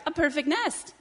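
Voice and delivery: high, loud chicken exclamation; excited cry. Acted. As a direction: cry it out, pitch leaping up. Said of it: "she cried".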